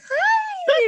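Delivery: very high, drawn-out mock hello; overly bright greeting. Retelling arrival with silly eyebrows, mock-polite high greeting. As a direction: greet them far too sweetly, hiding a laugh.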